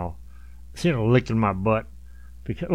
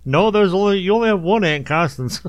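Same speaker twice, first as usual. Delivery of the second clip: mock reply; 8 semitones higher, 4 dB louder than usual. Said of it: "then you're like".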